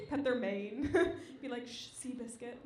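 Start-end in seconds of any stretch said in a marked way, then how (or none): 1.7-2.6 much softer hushing to a horse; quiet mock soothing. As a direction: hush him like a nervous racehorse.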